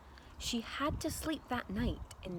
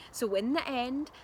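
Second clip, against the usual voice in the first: ordinary delivery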